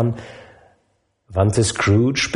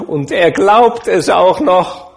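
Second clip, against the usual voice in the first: amused nephew; 8 semitones higher, 6 dB louder, slower than usual. Acted. Nephew laughing about his uncle.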